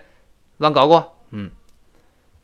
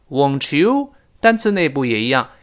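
ordinary talk

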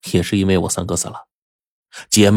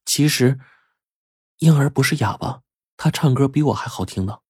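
put-on higher, soft young woman's voice; subdued female character confessing; quiet revelation; 7 semitones higher, 4 dB quieter than usual. A narrator's reading. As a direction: reveal it gently despite protests. Yan'er reveals the secret.

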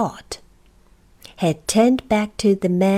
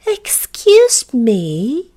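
put-on slow, higher, louder old-lady call; 4 semitones higher, 5 dB louder, much slower than usual. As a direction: politely catch a stranger's attention. Old lady politely addresses Uppity.

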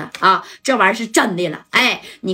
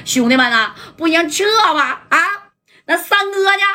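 urgent call to fall back, pitched higher; 左帅 calling retreat; 7 semitones higher than usual. Retold or imitated. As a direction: yell at the brothers to pull out, then ask after Third Brother. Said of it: "左帅当时就说了". (焦急地喊撤退)

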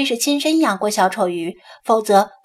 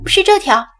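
higher, louder character reply; quick emphatic answer; 8 semitones higher, 6 dB louder than usual. A narrator's reading. Pointing out the sick clownfish, emphatic. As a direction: answer up and forceful.

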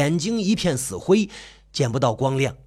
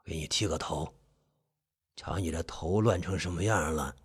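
very low, hushed husky voice; hoarse, low uncle; 13 semitones lower, 12 dB quieter than usual. Acted. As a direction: grumble softly, deep and hoarse. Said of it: "伯父的声音沙哑的有几分低沉".

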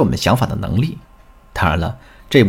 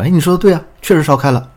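husband's voice; 8 semitones higher, faster than usual. Retold or imitated. Husband voiced in retold story.

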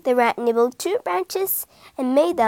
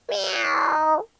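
a drowsy little meow; 4 semitones higher than usual. A narrator's reading. Cat meows.

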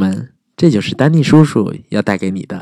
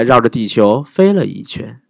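plain reading voice

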